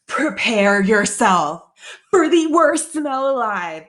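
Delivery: loud, slow mock-ominous proclamation; dramatic warning. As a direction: announce impending doom, stretching each word. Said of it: "I am reading the notes".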